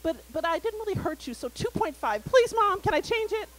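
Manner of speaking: mock child pleading, rising to a loud please; kid pleading. Son pleading to change score.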